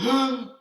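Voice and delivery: sudden loud gasp of awe; breath taken away by the painting; sharp gasp. A narrator's reading. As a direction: suck in breath, amazed. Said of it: "He did gasp".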